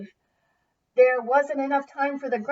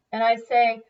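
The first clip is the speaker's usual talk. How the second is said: bossy chief; 7 dB louder than usual. A narrator's reading. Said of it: "said Chief Rockhead Grunt".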